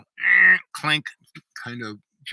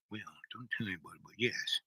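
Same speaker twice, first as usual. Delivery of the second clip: hushed confession; 3 semitones lower, 14 dB quieter, faster than usual. Retold or imitated. Chief admits secret, hushed.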